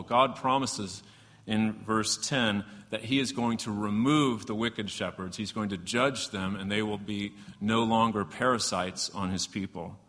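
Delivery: normal talking voice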